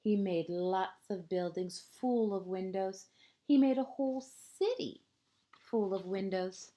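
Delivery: lilting picture-book narration, phrase ends hushed; reading to children. A narrator's reading. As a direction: read it like a bedtime story to a small child.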